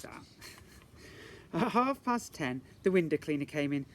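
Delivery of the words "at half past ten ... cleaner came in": ordinary delivery, nothing marked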